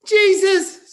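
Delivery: put-on very high, loud child echo; child parroting. Son echoing his father's curse, very high and loud. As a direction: chirp it like a kid copying dad.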